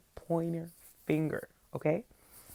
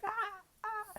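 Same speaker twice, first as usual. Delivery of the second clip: high mock yelps of pain, much higher; imitated pained cries; 6 dB quieter than usual. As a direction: replay a kid's crying yelps, much higher and softer. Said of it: "yelling in pain, crying".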